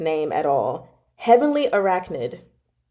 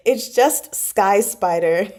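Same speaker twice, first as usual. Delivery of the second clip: ordinary talk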